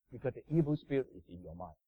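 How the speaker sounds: normal talking voice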